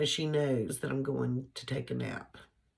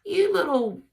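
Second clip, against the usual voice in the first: high, loud little-dog voice for You little; voicing the dog; 13 semitones higher, 6 dB louder than usual. Owner voicing her little dog who is mad, high and loud. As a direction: squeak it out as the cross dog. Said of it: "She's like".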